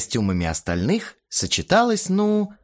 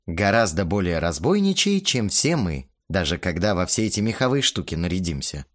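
ordinary delivery

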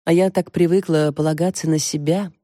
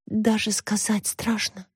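soft, slow, higher secretive voice; drawn-out dramatic hush; hushed confession; 5 semitones higher, 5 dB quieter, slower than usual. A narrator's reading. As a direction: confide it as if scared to say. Lovestruck girl confides a secret.

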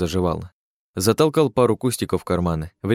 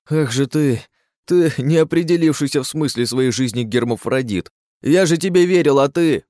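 outraged; 6 semitones higher, 3 dB louder than usual. A narrator's reading. Outraged accusation.

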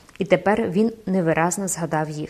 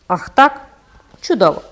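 furious snap; 2 semitones higher, 7 dB louder than usual. A narrator's reading. Phoebus, insulted by the ghost, answers in a furious snap. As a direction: bite it off, choking with anger. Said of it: "процідив він, задихаючись од люті".

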